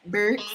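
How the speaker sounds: "Burks" said with an r, as one syllable; mispronounced word. Shown as a demonstration. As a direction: say "Burks" for Buicks, with an r sound. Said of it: "another word I can't say".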